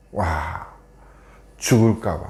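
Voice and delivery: low, hushed dread; softly dropped, fearful aside; acted fear. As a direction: voice quiet fear for one's life. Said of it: "두려워해서".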